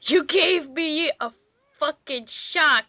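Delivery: ordinary talk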